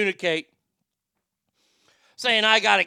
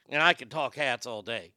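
normal talking voice

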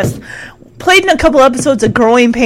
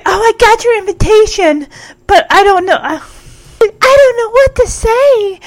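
put-on gushing girl; 7 semitones higher than usual. Nicole gushing in recap.

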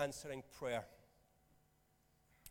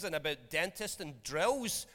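ordinary talk

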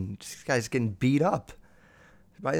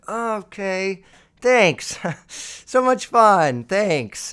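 high, louder mock-gratitude with a laugh; sarcastic thanks; 6 semitones higher, 5 dB louder, much slower than usual. Husband thanking his wife for the twist, loud sarcastic cheer. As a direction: fake cheer through the pain.

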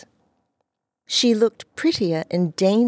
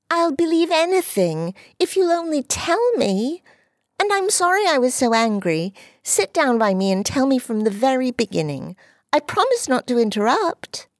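put-on high, sweet, coaxing girl's voice; high eager girlish voice; eager little girl; 8 semitones higher than usual. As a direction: win him back sweetly. Dulcie eager.

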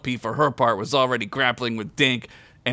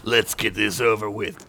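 lower, lightly voiced hero; interrupting hero; 4 semitones lower than usual. A narrator's reading. Weary fighter interrupting before the duel, lightly voiced low. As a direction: cut in with a hint of a low hero voice. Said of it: "Dan interrupted".

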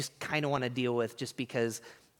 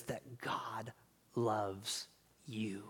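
slow, soft, whispered aside; 3 semitones lower, 9 dB quieter, much slower than usual. Real, not acted. Pastor telling the congregation God loves them, whispered. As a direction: lean in and whisper the truth. Intimate aside on God's love.

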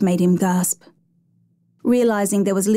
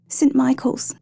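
put-on higher-pitched child's answer; little girl's voice; 5 semitones higher, slower than usual. A narrator's reading. Injured girl telling her rescuer her school, in a child's voice. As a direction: answer as a young girl, voice lifted.

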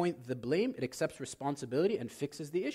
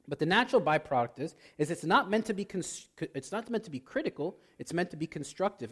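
normal talking voice

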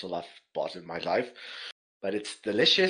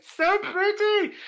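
very high, drawn-out squeal; gushing delight; 6 dB louder, much slower than usual. Retold or imitated. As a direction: gush loudly in a high, slow squeal. Gushing over the truffle.

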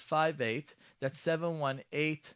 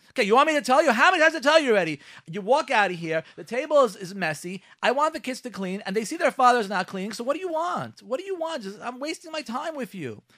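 high, loud fed-up wife; acted angry tirade; 9 semitones higher, 8 dB louder than usual. Wife to husband after being asked what's wrong, acted angry tirade. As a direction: let loose a mock scolding, high-pitched.

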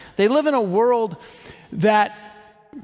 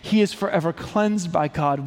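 usual speaking voice

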